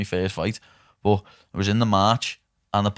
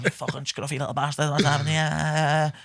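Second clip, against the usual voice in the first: fuming mum; 5 semitones higher, 3 dB quieter than usual. Retold or imitated. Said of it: "me ma was fuming".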